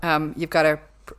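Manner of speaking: ordinary talk, nothing marked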